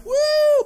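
high loud woo cheer. Real, not acted. Cheering his team.

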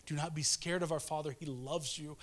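ordinary talk, nothing marked

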